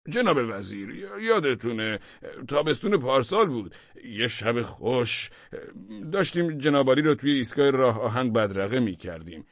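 high, loud, halting reminiscence; deputy prompting minister. A narrator's reading. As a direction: jog the boss's memory, stretching out each phrase. (یادآوری بلند و کشدار به وزیر)

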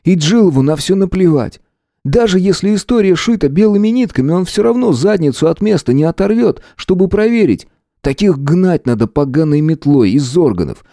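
heated complaint, pitch held high; bitter. A narrator's reading. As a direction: vent bitter frustration at a friend. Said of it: "с горечью воскликнул я". (с горечью, запальчиво)

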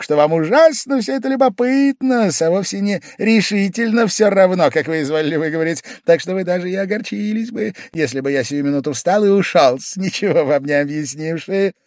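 high, needling, half-giggling; sly teasing. A narrator's reading. Pavel Pavlovich slyly teasing Velchaninov, high-pitched. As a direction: tease him with a smirk. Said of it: "хихикнул он вдруг".

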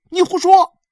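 put-on high, loud child's outburst; angry retort. A narrator's reading. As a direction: shout back as an indignant boy. Boy angrily calls her a liar.

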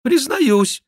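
high, loud penitent voice; put-on voice of a sham king; mock-king confession. A narrator's reading. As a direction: confess loudly, high above your own voice. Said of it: "продолжал мнимый Генрих".

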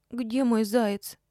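put-on high, slow little-girl voice; tearful child. A narrator's reading. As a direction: ask curiously through tears. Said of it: "заинтересовалась Светка сквозь слёзы". (детским голоском, сквозь слёзы)

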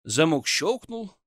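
plain reading voice

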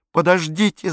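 loud high shout to stop him; boy shouting to stop Hitler eating. A narrator's reading. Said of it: "закричал Пьеро".

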